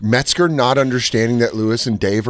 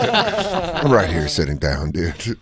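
laughing deadpan; 7 semitones lower, 4 dB quieter than usual. Retold or imitated. Sitting in crowd, laughing reply.

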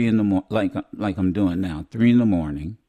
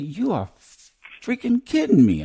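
stunned disbelief; 11 semitones higher than usual. Retold or imitated. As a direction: shout it like you can't believe who's here. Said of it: "I said".

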